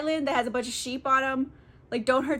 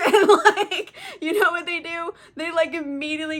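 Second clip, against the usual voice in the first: laughing; 6 semitones higher, 6 dB louder than usual. Real, not acted.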